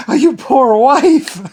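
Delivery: high, slow, laughing "poor wife"; laughing pity. Pretend. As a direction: laugh through mock sympathy.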